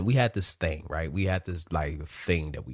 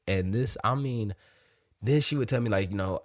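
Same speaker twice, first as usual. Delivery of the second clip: ordinary talk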